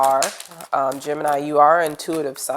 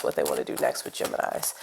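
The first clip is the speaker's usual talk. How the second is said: breathy unvoiced whisper, a bit quick; whispered question; 13 semitones lower, 8 dB quieter, faster than usual. Shown as a demonstration. Reader whispers her question to the cards, hushed. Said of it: "whispered repeat of question".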